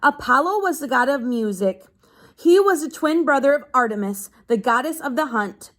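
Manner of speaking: steady read-aloud to a class; reading to children. Acted. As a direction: read the page out to the kids. Teacher reads a book aloud.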